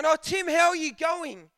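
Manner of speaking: hammed-up friendly caller; cheery caller. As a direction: greet like an old friend. Unknown caller greeting.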